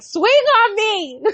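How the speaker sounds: high, drawn-out goading; mad dare. Retold or imitated. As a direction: retell a furious challenge, stretching the words. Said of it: "that made me so mad".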